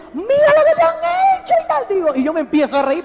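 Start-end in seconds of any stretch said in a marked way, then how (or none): 0.1-1.8 much higher, shocked voice of the car dealer; alarmed dealer. Retold or imitated. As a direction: squeal it like the upset dealer. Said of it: "el distribuidor se pone y dice". (el distribuidor alarmado, con voz mucho más aguda)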